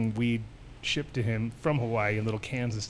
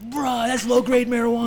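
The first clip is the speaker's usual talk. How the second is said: loud, higher principal; 10 semitones higher, 6 dB louder than usual. Retold or imitated. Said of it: "slams this fucking".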